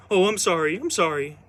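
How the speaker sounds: high, loud flustered apologies; imitating grandma cowering before the mom; mock grandma apology. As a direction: squeal it like a cowed old woman.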